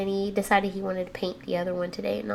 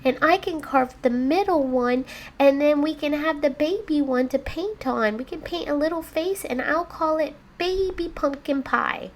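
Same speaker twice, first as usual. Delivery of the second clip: put-on higher, sweet-talking kid voice; pleading child; 6 semitones higher, 3 dB louder than usual. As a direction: coax Mommy like a little kid. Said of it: "he says".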